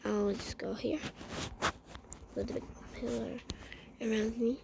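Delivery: soft unvoiced whisper. Real, not acted. Hiding behind a pillar, whispering. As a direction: sneak the words out.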